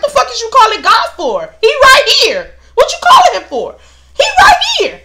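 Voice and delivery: very high, loud yell with a laugh; mock outraged shout at onlookers; indignant yell. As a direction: shout at the crowd, half laughing. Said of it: "I'm looking at them like".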